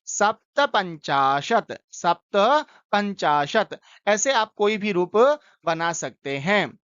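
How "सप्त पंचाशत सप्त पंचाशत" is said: सप्त पंचाशत said slowly and clearly; teacher modelling the Sanskrit name for fifty-seven; slow model pronunciation. Shown as a demonstration. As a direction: say सप्त पंचाशत slowly, one word at a time. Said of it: "उसको हम बोलेंगे".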